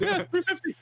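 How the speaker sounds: put-on high squeaky kid, smug. Kid voice answer.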